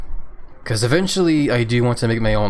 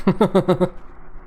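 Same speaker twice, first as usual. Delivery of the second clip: short laugh; 9 semitones higher than usual. Real, not acted. Laughs after hiccup remark.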